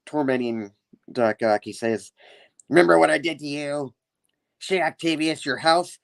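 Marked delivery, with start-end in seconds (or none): 2.7-6.0 lightly voiced taunting villain, pitched up; Green Goblin. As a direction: taunt with a hint of comic-book villain. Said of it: "memories as Green Goblin".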